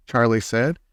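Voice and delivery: usual speaking voice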